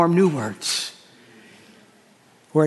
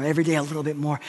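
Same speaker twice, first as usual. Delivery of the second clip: quieter, lingering repeat; soft reflective echo of the phrase; 5 semitones lower, 5 dB quieter, much faster than usual. Shown as a demonstration. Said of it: "that every day a little bit more".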